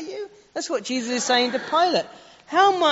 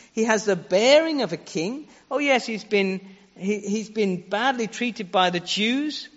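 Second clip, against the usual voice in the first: ordinary talk, nothing marked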